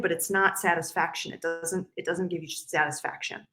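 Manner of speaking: ordinary talk, nothing marked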